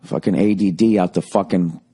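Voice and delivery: ordinary talk, nothing marked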